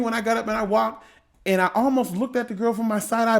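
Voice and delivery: ordinary talk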